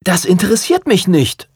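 high-pitched, stubborn retort; defiant. A narrator's reading. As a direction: refuse to back down. Young photographer defies the prince.

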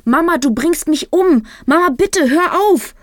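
high, loud desperate scream; panicked pleading. A narrator's reading. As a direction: beg her mother to let go. Being strangled, pleading.

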